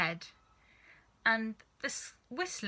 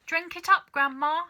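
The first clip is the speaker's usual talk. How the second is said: higher and louder, wickedly cheerful; gleeful grin; 9 semitones higher, 7 dB louder than usual. Acted. Father urging grandma to drink, grinning and higher. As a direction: egg her on with a grin. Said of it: "Mister Cranky said, grinning hugely".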